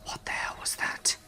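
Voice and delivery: hushed, frightened whisper. Retold or imitated. Friends hiding in the tent to each other, whispering. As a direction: whisper it under your breath. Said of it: "We whispered to each other".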